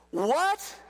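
high, rising incredulous exclamation; mock shock. Reader learning who is having a baby, mock shock.

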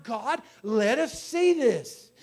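rising to a loud preaching cry; fervent prayer cry. Acted. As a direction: cry out to God in full force.

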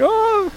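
sudden high yelp like Toto; quick high-pitched yip; imitated dog yelp. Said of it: "Toto going".

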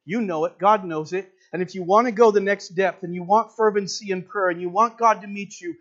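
ordinary talk, nothing marked

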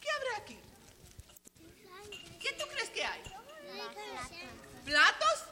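casi susurrando, mucho más suave y aguda; suspenso en voz baja. Acted. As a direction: baja la voz para crear misterio. Suspenso al contar vasos. (maestra cuenta vasos con los niños, en voz baja y misteriosa)

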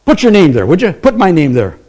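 usual speaking voice

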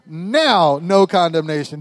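"Now" hit loud and high like a foot stamp; emphatic. Shown as a demonstration. Preacher has everybody stamp their foot, emphatic "Now". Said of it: "When you get emphatic".

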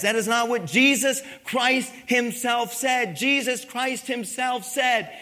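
loud, high, building sermon delivery; full-force preaching. Real, not acted. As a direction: proclaim it to the whole congregation with mounting fervor.

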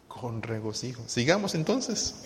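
ordinary talk

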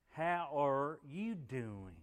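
exaggerated, slow Southern speech; slow Southern drawl. Preacher imitating how Southerners talk slow, Southern drawl. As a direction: drag every word out, Southern style. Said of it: "they talk slow".

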